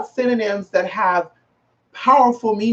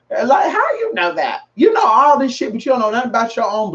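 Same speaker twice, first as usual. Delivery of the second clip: shouting; 5 semitones higher than usual. Real, not acted. Calling out a man online.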